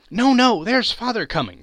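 put-on high, loud children's cry; excited cry. A narrator's reading. Children warning Martha of father's arrival, loud high cry. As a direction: blurt it out in a rush of glee. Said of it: "cried the two young Cratchits".

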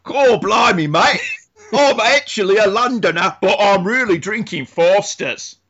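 hammed-up Cockney trying to pass for Aussie, high-pitched; imitated Cockney accent. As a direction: tease him with a mock Cockney accent. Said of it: "you slipped into Cockney".

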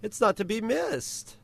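put-on high Valley Girl lilt; sing-song teen girl voice. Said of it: "the Valley Girl voice for you".

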